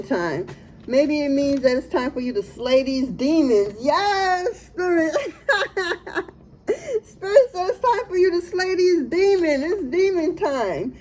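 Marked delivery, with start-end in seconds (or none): 3.8-10.9 high, laughing while repeating. Real, not acted. Reader laughing as Spirit confirms, high. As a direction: giggle through the words.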